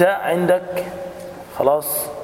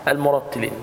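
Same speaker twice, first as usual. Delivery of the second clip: ordinary talk, nothing marked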